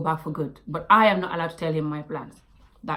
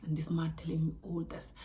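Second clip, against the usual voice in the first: whispered disbelief; 4 semitones lower, 12 dB quieter than usual. Real, not acted. Recalling the first date at Starbucks, whispered. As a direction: drop to a whisper, stunned.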